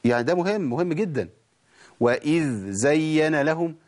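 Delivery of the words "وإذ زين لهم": very slow وإذ زين with the dhal and the z kept apart; فصل الذال عن الزاي ببطء; correct, dh then z. Shown as a demonstration. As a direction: separate ذ and ز clearly, slowly. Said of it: "وأفصل بينهم".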